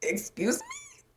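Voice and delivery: very high, drawn-out squeak of disbelief; soft, squeaky mock shock; incredulous. Retells her shocked reaction.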